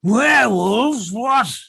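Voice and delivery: high, loud, slow shocked shout; shocked old man. Acted. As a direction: shout it in disbelief.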